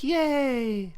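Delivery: put-on high squeaky kid cheer. Kids cheering at a dinner table adventure, high excited squeal. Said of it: "The kids are like".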